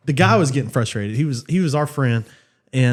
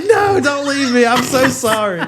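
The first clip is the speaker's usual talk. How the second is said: high, wailed, hammed-up begging; mock crying plea; 9 semitones higher, 3 dB louder than usual. Boyfriend crawls after departing girlfriend, mock wailing plea. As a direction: imitate a desperate boyfriend crying out. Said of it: "he's crying and".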